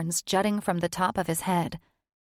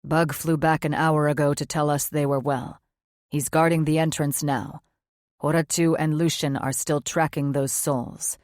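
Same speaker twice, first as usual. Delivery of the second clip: a touch lower, tired demon; low, subdued male voice; lightly voiced weary man; 4 semitones lower, 3 dB louder than usual. Male character voice.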